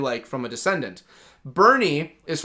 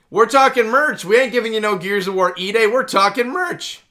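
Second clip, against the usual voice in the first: hyped-up merch pitch; 6 semitones higher, 5 dB louder than usual. Real, not acted. Hyping up the merch.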